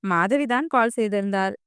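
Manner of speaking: plain reading voice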